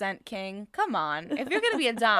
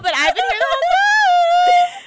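much higher and louder, pleading; mock whiny plea; 6 dB louder than usual. Co-host playing the needy sub, high whine. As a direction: whine like a neglected lover.

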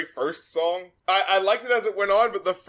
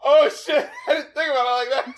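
amused surprise; 7 semitones higher, 4 dB louder than usual. Real, not acted. Reacting to friend's dark misreading.

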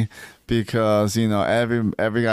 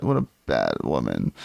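mocking him in a much lower voice; mock husband voice; 12 semitones lower than usual. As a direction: drop low and do a sulky husband. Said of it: "You're such a great husband".

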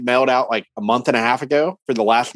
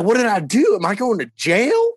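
mock-panicked, high-pitched question; retold freak-out; 9 semitones higher than usual. Man retelling his panic over a warrant, high mock alarm. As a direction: imitate yourself freaking out, pitch jumping up. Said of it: "I started freaking out".

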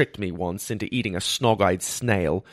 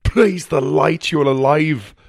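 joyful shout; 5 semitones higher, 6 dB louder than usual. A narrator's reading. Healer to revived patient, jubilant shout. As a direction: shout it with relief. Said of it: "he shouted".

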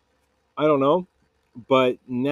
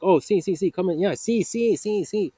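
higher-pitched imitation of the neighbor saying sí sí; neighbor knowing; 7 semitones higher than usual. Neighbor waves the stung narrator inside, higher mock Spanish-accented sí. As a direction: mimic him waving you in, higher and knowing. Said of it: "And he's like".

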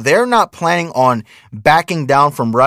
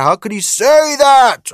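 much higher, slow, hammed-up pearl-clutching "How could he say that?"; mock outrage, much higher; 11 semitones higher, 3 dB louder than usual. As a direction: stretch out "How could he say that?" way up high like a scandalised critic. Mocking outraged people.

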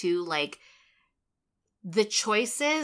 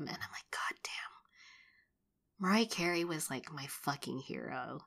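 soft whisper, breathy and hushed; 3 semitones lower, 12 dB quieter than usual. Real, not acted. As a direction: drop to a whisper. Unvoiced and very soft.

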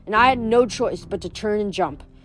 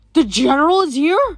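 shocked; 4 semitones higher, 6 dB louder than usual. Acted. Zoe stunned by news.

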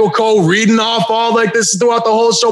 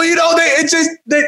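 ordinary talk, nothing marked

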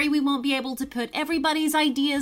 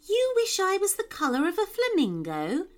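unsure; 8 semitones higher, faster than usual. Acted. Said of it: "sounding unsure".

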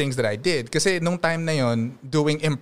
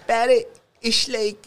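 Alabang accent; 6 semitones higher, slower than usual. Shown as a demonstration. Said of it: "yung Alabang mas zh".

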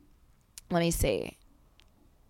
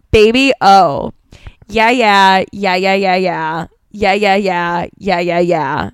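spoken yeahs in even, lowered groups; interpretive lyric read; 4 semitones lower, 3 dB louder than usual. Shown as a demonstration. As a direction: recite the refrain like a script, not a song. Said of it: "it's an interpretive read".

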